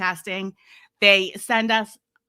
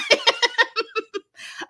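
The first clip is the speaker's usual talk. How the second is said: laugh; 14 semitones higher than usual. Real, not acted.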